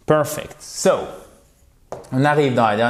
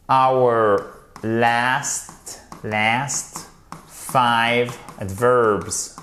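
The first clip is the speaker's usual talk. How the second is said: slow, spaced-out teacher's dictation; deliberate modelling for learners to repeat; word-by-word model; much slower than usual. Shown as a demonstration. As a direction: give each word its own space, finishing every final consonant.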